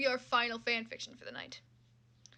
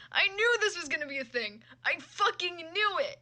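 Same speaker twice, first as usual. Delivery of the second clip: exasperated outburst; 6 semitones higher, 5 dB louder than usual. Real, not acted. Streamer venting at the fan fiction search. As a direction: vent that you saw it coming.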